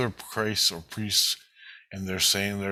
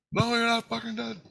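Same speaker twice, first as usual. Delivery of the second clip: retold answer pitched way up; high-voiced reply. Christ answering the question about being dead, high voice.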